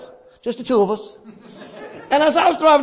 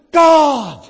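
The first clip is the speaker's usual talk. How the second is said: ordinary delivery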